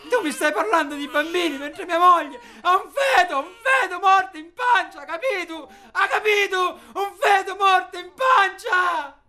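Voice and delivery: high, loud, tear-choked yelling; anguished shout. Acted. Husband screaming at the man about his wife's dead baby, anguished. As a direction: scream it through sobs at him.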